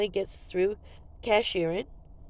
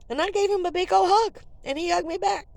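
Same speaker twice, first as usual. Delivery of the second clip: high, bright-toned recounting; excited; 10 semitones higher, 3 dB louder than usual. Real, not acted. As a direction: tell it with delight.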